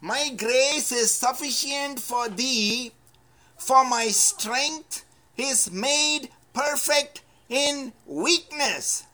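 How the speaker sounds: slow, lifted reading of the full verse; slow proclaimed verse. Shown as a demonstration. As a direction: read it out slowly, building to weakness. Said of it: "let us read the second part".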